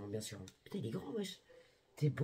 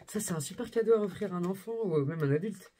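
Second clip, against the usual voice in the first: usual speaking voice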